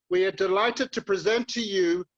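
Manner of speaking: normal talking voice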